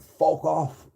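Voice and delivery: a touch of an in-your-face fuck off; mild mock snarl. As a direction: retell his sneer, lightly. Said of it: "got right in my face and went".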